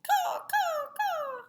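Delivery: very high cawing crow voice. Acted. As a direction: squawk it like a cheeky crow. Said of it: "the crow only replies".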